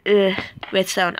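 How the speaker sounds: ordinary delivery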